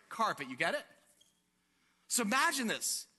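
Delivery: ordinary delivery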